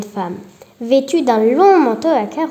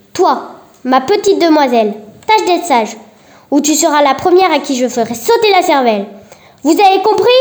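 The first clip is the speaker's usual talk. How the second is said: loud, higher menacing yell; yelled threat; 3 semitones higher, 5 dB louder than usual. Acted. Marie threatens the girl and yells at everyone, loud. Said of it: "hurla-t-elle à la cantonade".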